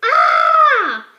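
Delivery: loud high shriek of fright; sudden scared yell at a worm; startled yell. Acted. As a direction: scream as if something jumped at you. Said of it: "jumped out of his seat and yelled".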